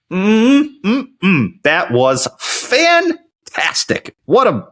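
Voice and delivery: slow drawn-out yum; savoring mmm. Real, not acted. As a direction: relish every bite. Savoring the food he is eating.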